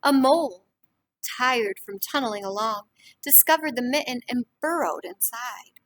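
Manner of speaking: lively storybook reading to a class; reading to children. A narrator's reading. Teacher reading a picture book to the class, lively. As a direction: read it warmly to young listeners.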